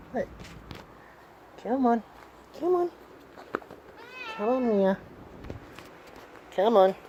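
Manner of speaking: high-pitched coaxing voice for a cat; high baby talk to a pet cat. Acted.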